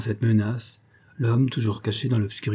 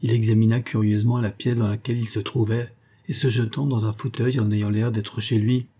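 plain reading voice

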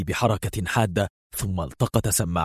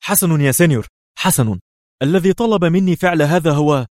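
high, raised, frightened rush to comply; trembling haste; 9 semitones higher, 6 dB louder than usual. A narrator's reading. Threatened informant starting to confess, frightened and high-pitched. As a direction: give in hurriedly out of fear. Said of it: "ارتجف لوبيز وهو يقول في سرعة".

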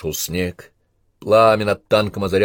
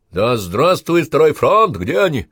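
high, loud hurrah; loud rallying cry; mock cheer; 7 semitones higher, 6 dB louder, slower than usual. Soldier hails second front mid-battle.

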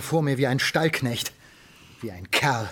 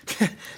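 scornful chuckle. Acted. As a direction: huff out a bitter laugh. Father scoffs recounting doctor.

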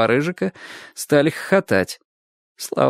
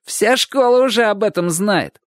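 high, cocksure schoolboy taunt; emphatic and sure; confident; 9 semitones higher than usual. A narrator's reading. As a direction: announce it as common knowledge. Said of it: "уверенно ответил Шурик".

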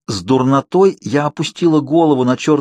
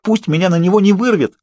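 much higher, pleading cry; desperate wail; 8 semitones higher than usual. A narrator's reading. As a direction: beg the heavens in panic. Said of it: "Калин будто не слышал моих воплей".

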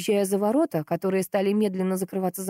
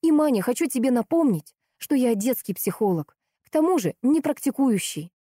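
higher-pitched pointed reminder; indignant; 7 semitones higher, 3 dB louder than usual. A narrator's reading. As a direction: correct them, piqued and emphatic. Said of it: "возмущалась Лиза". (возмущённо, с нажимом)

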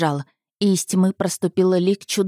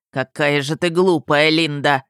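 derisive dragon voice, no real laugh; mocking monster; 3 semitones lower than usual. A narrator's reading. Dragon father mocks his daughter's belief, derisive monster voice. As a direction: sneer at her in a monster's voice. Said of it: "рассмеялся монстр".

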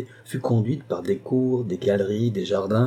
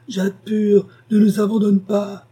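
much higher, louder wail of "Jade pure"; moaning plea; 10 semitones higher, 5 dB louder than usual. Acted. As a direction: grandmother begs her not to leave, wailing. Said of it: "Gémit l'aïeule".

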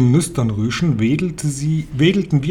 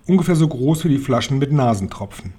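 ordinary delivery, nothing marked

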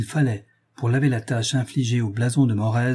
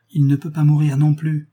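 louder and higher, pressing the point; a sister's raised, insistent plea; insisting; 5 semitones higher, 5 dB louder than usual. A narrator's reading. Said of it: "insista Lucile".

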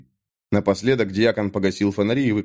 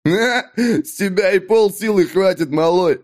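much higher, loud scornful laugh; bursting out laughing; 8 semitones higher, 3 dB louder than usual. A narrator's reading. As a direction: guffaw and jeer at the kid. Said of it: "Даг разразился хохотом". (Даг хохочет над Натаном)

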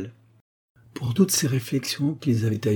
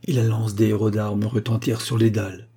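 ordinary delivery, nothing marked

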